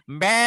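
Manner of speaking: sudden loud bleat. Retold or imitated. Teacher imitating the voice that startled him, sudden bleat. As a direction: let out an abrupt "mbe" bleat. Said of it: "tiba-tiba kenceng".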